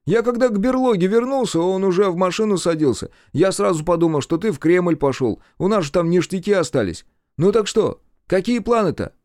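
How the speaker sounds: high-pitched, animated partner voice; lively high character voice; chatty sidekick. A narrator's reading. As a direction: fill the boss in eagerly. Partner's figure voice.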